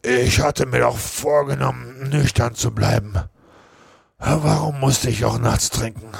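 put-on confused drunk begging to know what he did; despairing Potter. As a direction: beat yourself up, fearing the worst. Potter despairs over drinking.

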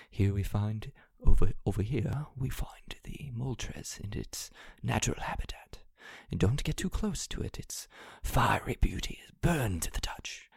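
hushed documentary narrator whisper; nature documentary narrator. Pretend. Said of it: "I wanna have him narrating".

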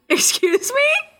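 high, drawn-out mock indignation; affronted disbelief. Telling friend how she answered the rude customer, mock affronted. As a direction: squeak it slowly, retelling your shock. Retold affront at being sworn at.

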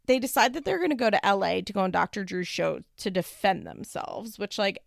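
usual speaking voice

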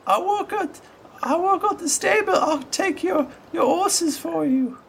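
put-on very high, bright-eyed small boy; high child voice of a small boy with a crutch; little boy voice. GM voicing the boy.

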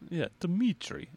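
slow heavy-tongued Russian Dmitry; Russian accent. Shown as a demonstration. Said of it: "Say Dmitry".

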